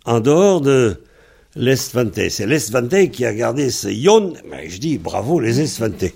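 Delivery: ordinary delivery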